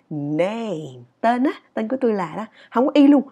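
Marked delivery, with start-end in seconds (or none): 0.1-1.0 "Name" long, sliding low; English falling glide. Shown as a demonstration. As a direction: let "Name" fall slowly. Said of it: "Nghe nó sang hơn không". (đọc "Name" kéo dài, đi xuống)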